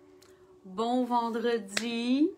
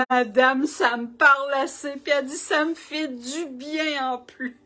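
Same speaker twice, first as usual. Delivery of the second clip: moved waitress; 4 semitones higher, 6 dB louder than usual. Retold or imitated.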